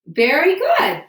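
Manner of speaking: high, loud burst of praise; delighted teacher praise; excited praise. Real, not acted. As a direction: cheer the student on. Teacher praises a correct answer.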